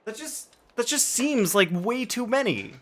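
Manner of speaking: high-pitched, baffled protest; disbelieving. Real, not acted. Streamer baffled by the huge fuel count, disbelieving.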